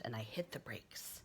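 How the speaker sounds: put-on boy stopping short; hushed sudden stop. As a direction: drop to a whisper as you brake.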